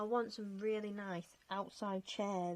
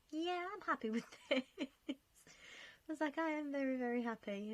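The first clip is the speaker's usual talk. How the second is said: laughing happily; 7 semitones higher than usual. Real, not acted. Delighted with her finished build.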